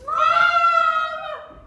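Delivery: very loud high shout; calling out. Acted. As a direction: yell it across the house. Calling out to stepmother.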